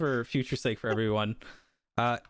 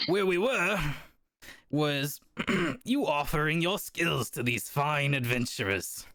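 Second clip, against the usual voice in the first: Burkhead speaks up; 5 semitones higher than usual. Acted. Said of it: "Burkhead speaks up".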